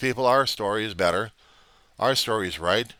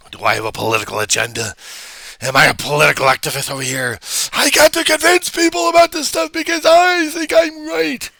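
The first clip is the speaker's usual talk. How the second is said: over dramatic; 8 dB louder than usual. Shown as a demonstration. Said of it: "I can be over dramatic".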